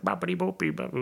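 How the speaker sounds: imitating Italians yelling gibberish; rapid mock Italian babble. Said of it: "yelling at us, like".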